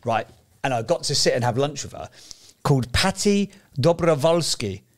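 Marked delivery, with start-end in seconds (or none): 2.6-4.8 slow mock Eastern European accent; a light Eastern European accent on the name. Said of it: "I don't know why I did it with that accent".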